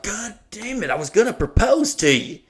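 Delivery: high, mock frustrated groan of a boyfriend; exasperated Evan. Boyfriend whose surprise was spoiled, mock exasperated. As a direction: imitate a caught-out fiancé, pitched high. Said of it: "And Evan was like".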